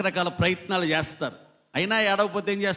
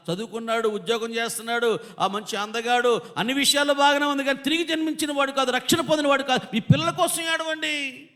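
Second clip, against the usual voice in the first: high, pleading exhortation; impassioned preaching; 7 semitones higher than usual. Real, not acted. Preacher urging parents to weep for unsaved children, impassioned pleading.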